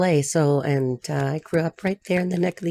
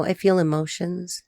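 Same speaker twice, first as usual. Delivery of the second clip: ordinary talk, nothing marked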